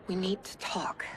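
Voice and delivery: soft hushed whisper; whispered warning to husband. Real, not acted. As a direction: ease into bad news gently. Wife breaks bad news.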